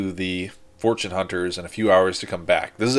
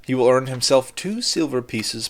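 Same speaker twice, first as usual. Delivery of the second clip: measured creditor, stretching the key words; lightly voiced formal creditor; 6 semitones higher, slower than usual. Creditor explains terms of repayment to visitors, lightly voiced formal figure. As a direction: lightly play a formal, deliberate employer. Game master answers as the creditor.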